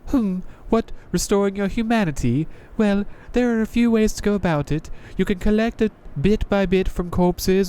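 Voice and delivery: a jaded knight greeting a newcomer; Crestfallen Knight voice. Acted. Knight explains humanity.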